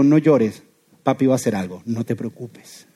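low, softening fatherly comfort; compassionate reassurance. Acted. Father comforting his crying son, compassionate reassurance. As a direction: reassure tenderly, slowing to a hushed close. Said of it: "se parece a esto".

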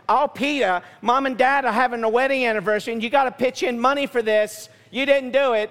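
mock Italian sister, nagging; Italian accent. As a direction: scold a brother with a hint of Italian. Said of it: "I don't know why she turned Italian".

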